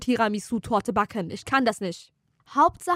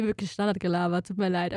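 ordinary talk, nothing marked

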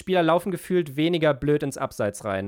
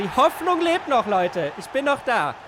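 excited cheer; 8 semitones higher than usual. Real, not acted. Scoring a goal in FIFA.

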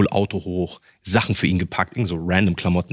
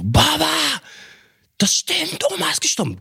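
much higher, frantic announcement; panicked cry. Retold or imitated. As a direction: blurt it out in shock, voice jumping up. Panicked news to father. (panisch und viel höher)

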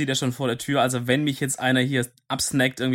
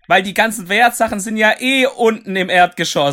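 loud, high bait call; 6 semitones higher, 7 dB louder than usual. Pretend. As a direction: call it out to lure him down. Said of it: "auf Bait machen und sagen".

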